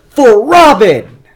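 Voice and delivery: high, full-throated heroic shout; loud, drawn-out war cry; battle cry. Retold or imitated. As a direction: bellow it like a charge into battle. [name] cuts in.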